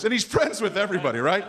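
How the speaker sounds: usual speaking voice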